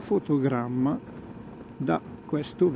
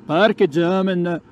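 mock German; 5 semitones higher, 6 dB louder than usual. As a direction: shout pseudo-German parking order. (finto tedesco ad alta voce)

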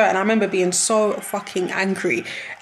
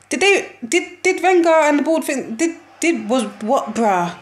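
high, exasperated spluttering; spluttering in disbelief; 6 semitones higher than usual. Real, not acted. Outraged at the club's bid.

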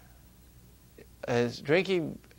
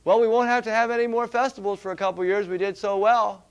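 mock committee member; 5 semitones higher, 7 dB louder, faster than usual. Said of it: "they don't tell you".

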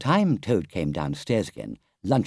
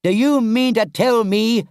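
shouting; 8 dB louder than usual. A narrator's reading. Rat thumps the table at Toad, shouting. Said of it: "shouted the rat".

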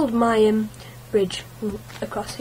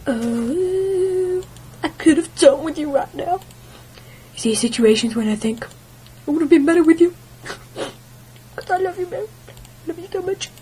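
higher, lightly crooned love line; mock love song; 7 semitones higher, 4 dB louder than usual. Love song to the shovel, mockingly crooned. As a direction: croon it to your sweetheart, hammed. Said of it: "a love song".

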